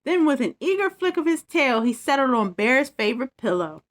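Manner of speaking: put-on lively bedtime story read to kids; story narration. A narrator's reading. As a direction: read it like a storybook for little ones. Reads bedtime story aloud.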